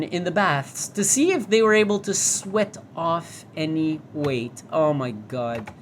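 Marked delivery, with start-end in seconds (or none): none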